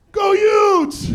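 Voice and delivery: loud high fan shout; cheer. Retold or imitated. Checkout guy cheering at him, loud shout. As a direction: belt it like a proud fan. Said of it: "the checkout guy goes".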